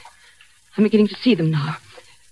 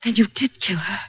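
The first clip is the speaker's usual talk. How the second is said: horrified whisper; 6 dB quieter than usual. Acted. Realizing the murder.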